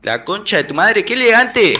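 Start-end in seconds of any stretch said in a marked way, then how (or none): 1.0-1.7 mock posh. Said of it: "te quería hacer que hablar como elegante".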